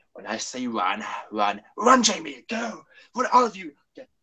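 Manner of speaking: urgent call to flee, rising sharply; Troughton impression. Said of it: "my Charlton impression".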